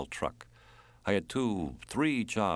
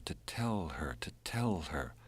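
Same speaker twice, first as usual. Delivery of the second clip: whisper; 6 dB quieter than usual. A narrator's reading. Narration echoes her whispered begging.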